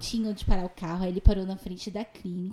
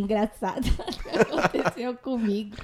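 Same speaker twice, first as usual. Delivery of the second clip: giggling as she introduces her story; laughing. Real, not acted. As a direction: talk through a chuckle. Amused before her funny story. (rindo ao contar)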